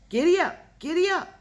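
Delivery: very high, loud repeated yells at the oxen; desperate shouting. A narrator's reading. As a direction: holler over and over to make them pull. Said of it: "Almanzo kept shouting".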